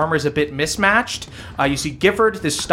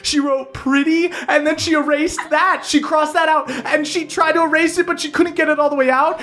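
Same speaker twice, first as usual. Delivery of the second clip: high, giddy bully reading a diary aloud; mocking reading aloud; 10 semitones higher than usual. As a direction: read it out high and gleeful, barely holding back laughter. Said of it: "reading a bunch of mantras and laughing".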